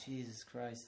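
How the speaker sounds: ordinary talk, nothing marked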